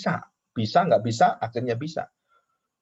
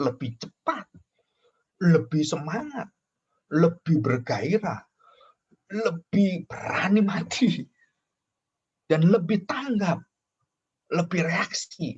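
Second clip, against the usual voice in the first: slow, climbing list sung out higher and higher; rising fervour; 5 semitones higher, slower than usual. Real, not acted. Preacher to congregation, listing Peter's zeal with rising fervour. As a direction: stretch each lebih, lifting the pitch with fervour.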